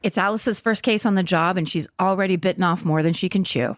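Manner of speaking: upbeat sponsor read. Acted. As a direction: sell it like a radio spot. Reads sponsor ad.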